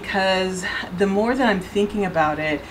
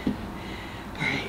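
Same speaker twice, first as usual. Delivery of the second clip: ordinary delivery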